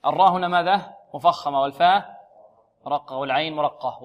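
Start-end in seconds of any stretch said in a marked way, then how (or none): none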